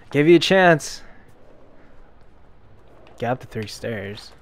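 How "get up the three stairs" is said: normal talking voice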